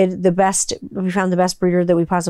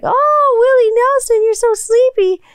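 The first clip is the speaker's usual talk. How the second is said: put-on much higher, louder baby-talk coo; cooing puppy talk; 4 dB louder than usual. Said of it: "And I was like".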